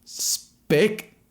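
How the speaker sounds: very high, hoarse scream of a slur; raw scream. A narrator's reading. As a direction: tear up the throat on the slur. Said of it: "taking a grater to his vocal cords".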